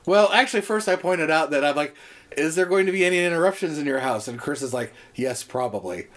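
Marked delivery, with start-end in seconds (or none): none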